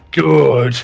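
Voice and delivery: loud, high, drawn-out relish; menacing madman. Acted. Grinning man about to charge upstairs, manic American voice. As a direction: say it through an intense grin. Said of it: "intense grin".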